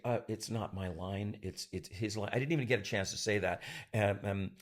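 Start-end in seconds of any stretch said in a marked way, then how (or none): 0.1-2.3 mumbled timid reply. Retold or imitated. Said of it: "I wanted to say".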